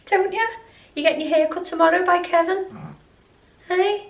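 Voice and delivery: high, loud coaxing pet voice; talking to the dog. Real, not acted. Owner teasing her snoring dog in a high pet voice.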